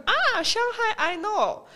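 high, eager teacher voice; teacher's reply. Retold or imitated. Imitating the Seattle teacher, high and knowing. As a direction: play the teacher, bright and pleased. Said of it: "然后老师会说".

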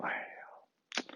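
breathy whispered ai-ya of regret; soft resigned whisper; whispered sigh. Retold or imitated. Old man opens eyes, sighs.

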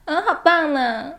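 put-on slow, sweet babyish coo; cutesy clip voice. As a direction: drawl it out sweetly and cutely. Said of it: "就用夹子音说话".

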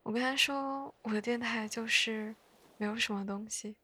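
ordinary talk

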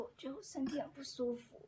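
soft, feeble fake-sick voice; faking sick. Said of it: "就开始装病". (装病的虚弱小声)

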